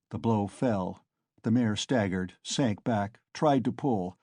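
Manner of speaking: usual speaking voice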